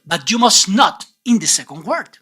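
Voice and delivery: ordinary talk, nothing marked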